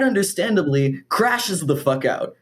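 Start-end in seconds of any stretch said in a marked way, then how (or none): none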